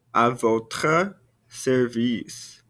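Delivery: à votre service said slowly and clearly; slow model. Shown as a demonstration. Teacher modelling the formal reply slowly to students. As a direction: stretch each word out for the class. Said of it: "Répétez-moi".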